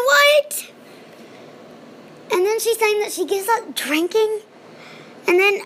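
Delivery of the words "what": high, louder what; a disbelieving yelp; incredulous what. Real, not acted. Vlogger reacting to the mother appearing, disbelieving.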